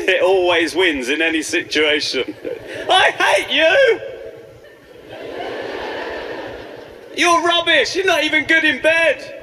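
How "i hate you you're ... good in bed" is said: mock furious shout. Defeated bathmate screaming at the bubble beard, high hammed-up yell.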